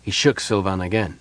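ordinary delivery

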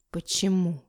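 croaked, low and quiet; hoarse croak. A narrator's reading. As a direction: rasp the word out weakly. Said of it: "прохрипел младший Муромцев".

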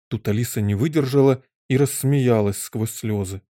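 usual speaking voice

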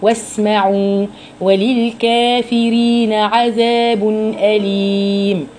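slow chanted recitation, the سين held; Quran recitation. Shown as a demonstration. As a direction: recite with the س of واسمعوا sustained. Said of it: "تبيني صفات الحرف في السكون".

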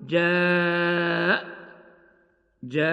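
جاء stretched very slowly on the ا before the hamza; tajweed madd on جاء; drawn madd. Shown as a demonstration. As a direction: hold جاء long. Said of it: "نقول".

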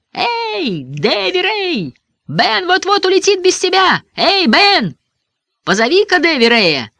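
high, stretched hailing shouts; calling out. A narrator's reading. Boy calling to flying Ben and grounded Davy Ray, high holler. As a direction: holler up into the sky to friends.

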